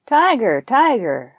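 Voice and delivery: a bold call across to the tiger. Acted. Buffalo calling the tiger out of his lair, bold call. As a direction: hail the tiger like a challenger. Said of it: "called out".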